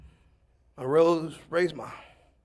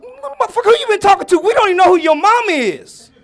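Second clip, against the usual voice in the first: laughing shout, much higher and louder; shouted comeback; 18 dB louder than usual. Retold or imitated. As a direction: yell it back at the kid while laughing. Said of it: "I said".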